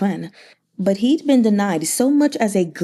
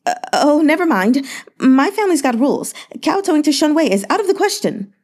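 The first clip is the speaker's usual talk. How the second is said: quick, raised, hurried excuses; flustered backpedal; 7 semitones higher, faster than usual. Acted. Merchant hastily refusing to kowtow, flustered and quick. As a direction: back out fast and nervously. Hasty retreat.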